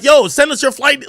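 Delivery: loud, high hyped retelling; loud mock urgency; excited. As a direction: blast it out hyped. Retelling pushy requests, loudly.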